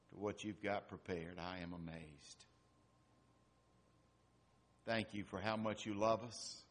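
hushed, halting prayer with long pauses; heartfelt prayer. Real, not acted. Preacher prays to God after the reading, quietly amazed. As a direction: pray in awe, letting phrases fall soft.

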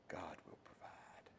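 soft, slow whisper; whispered answer. Acted. Pastor voicing Abraham's answer in a whisper. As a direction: breathe Abraham's answer quietly.

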